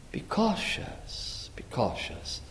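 whispered, slow warning to be cautious; hushed warning. Real, not acted. Warning the congregation softly.

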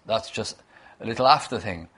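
ordinary talk, nothing marked

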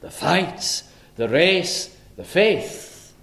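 "fights", "race", "faith" each set apart and pitched high; emphatic nouns. Shown as a demonstration. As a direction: lift "fights" and "race", land hard on "faith". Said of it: "that's the emphasis".

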